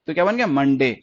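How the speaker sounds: usual speaking voice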